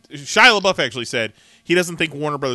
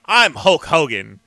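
louder, lightly put-on announcement; Stan Lee; 8 dB louder than usual. Host acts out comic creator introducing himself, lightly hammed-up. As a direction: declare it with mock showmanship. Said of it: "Stan Lee going".

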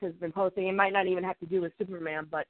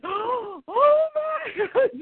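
high, loud, drawn-out shocked cry; freaked out; 4 dB louder, much slower than usual. Retold or imitated. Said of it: "freaked out".

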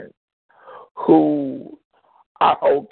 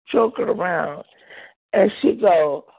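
ordinary talk

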